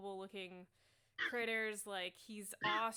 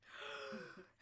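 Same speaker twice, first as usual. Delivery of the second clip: awed gasp; 12 semitones higher than usual. Acted. NPC gasps in awe.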